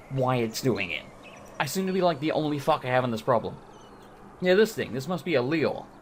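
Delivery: usual speaking voice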